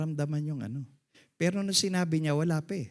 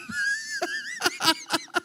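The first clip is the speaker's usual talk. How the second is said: high laugh. Real, not acted. Laughs after punchline.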